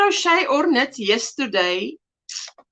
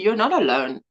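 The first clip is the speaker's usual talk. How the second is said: normal talking voice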